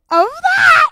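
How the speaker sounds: slow, rising into a high laughing shriek; cringing laughing outburst; mortified laugh. Real, not acted. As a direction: groan in mortified embarrassment, laughing. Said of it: "so fucking mortified".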